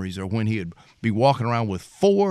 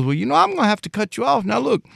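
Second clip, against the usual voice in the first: higher, fast matter-of-fact warning; imitating dad; 12 semitones higher, faster than usual. Utility man dad warns Betty he has to cut her off, high and fast. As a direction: break the bad news briskly. Dad voiced in retold story.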